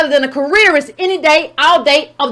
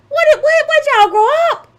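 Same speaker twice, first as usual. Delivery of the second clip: incredulous; 10 semitones higher than usual. Real, not acted. Incredulous reaction to the quote.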